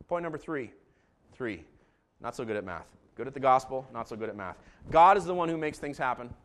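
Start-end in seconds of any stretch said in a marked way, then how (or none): none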